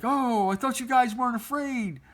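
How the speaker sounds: high, teasing big-brother voice; mocking taunt. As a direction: rub it in that they ran away scared. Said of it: "He's like".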